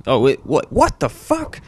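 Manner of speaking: high, startled double-take; rising, high-pitched mock astonishment; mock shock. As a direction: burst out in baffled disbelief. Acts shocked at the screen.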